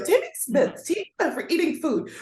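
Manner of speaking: put-on high teacher correcting a child; scolding full-name emphasis. Shown as a demonstration. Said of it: "say the first and last name for that emphasis".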